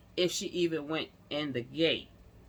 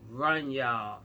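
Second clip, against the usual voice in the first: ordinary talk, nothing marked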